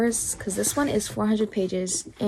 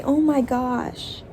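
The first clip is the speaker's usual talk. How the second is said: stunned; 3 semitones higher, 3 dB louder, much slower than usual. Real, not acted. Shocked by plot twist.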